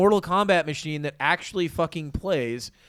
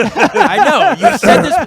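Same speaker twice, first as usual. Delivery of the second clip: laughing; 12 semitones higher, 10 dB louder, slower than usual. Real, not acted. Laughing at repeated complaint.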